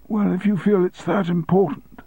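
high, thin grudging reply; reluctant sick man. Acted. Sick deputy giving in, reluctant. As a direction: give in weakly. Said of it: "said Peter".

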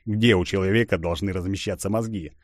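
ordinary delivery

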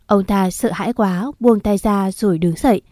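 usual speaking voice

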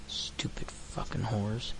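ordinary delivery

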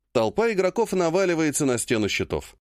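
ordinary delivery

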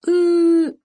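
high, loud drawn-out bawl; child's wail. A narrator's reading. Boy cries after answering the doctor, wailing. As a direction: whine into a long sob.

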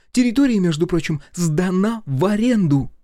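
put-on high, loud feisty girl; indignant Masha. A narrator's reading. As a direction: snap back indignantly.

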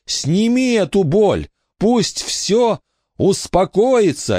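high slow pained cry; anguished plea. A narrator's reading. Billy pleading for the pain to be taken away. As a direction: beg for the pain to end.

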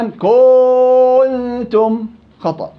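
كنتم with the final vowel stretched out very long, slowly; wrong lengthened vowel. Shown as a demonstration. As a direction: drag out the end of كنتم as a wrong reading. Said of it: "إذن كنتم تنطق خطأ".

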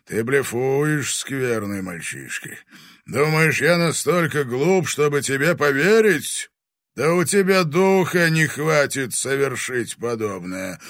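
scornful challenge. A narrator's reading. Fire dragon calling the boy's bluff, scornful challenge. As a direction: throw it at him as a dare. Дракон бросает вызов мальчишке.

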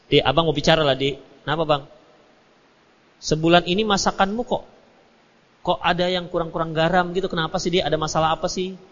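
gentle husband. Retold or imitated. Husband sits his wife down after dinner, soft and caring.

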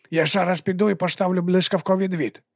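high-pitched henchman with slushy S; eager lisping servant. A narrator's reading.

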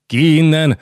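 high, loud shriek. Acted. Queen shrieks at the count to get out. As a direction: shriek them out of the room. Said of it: "sivította Barbara".

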